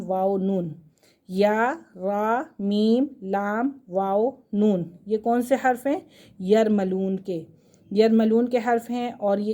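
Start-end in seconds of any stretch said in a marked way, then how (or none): 1.3-4.9 each letter name drawn out slowly, one by one; deliberate slow recital of the letter names; slow letter drill. Shown as a demonstration. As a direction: spell the letters out slowly for the class.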